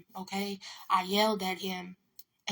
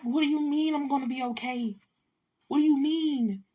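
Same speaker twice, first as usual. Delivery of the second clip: higher-pitched retort in disbelief; incredulous; 5 semitones higher than usual. Retold or imitated. Answering sister's reassurance, acted-out incredulity. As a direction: throw the question back, baffled.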